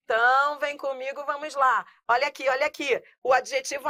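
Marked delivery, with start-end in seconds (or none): none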